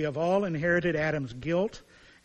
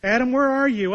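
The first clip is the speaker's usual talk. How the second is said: loud, higher call across the garden; 8 semitones higher, 10 dB louder than usual. Acted. God calling out to hiding Adam, loud and searching.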